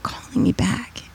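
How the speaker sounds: whispered, slow and hushed; soft whisper. Real, not acted. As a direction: breathe it out gently. Describing humanity calling her back.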